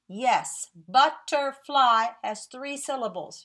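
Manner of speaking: ordinary talk, nothing marked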